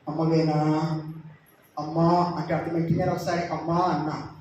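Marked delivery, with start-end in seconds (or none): none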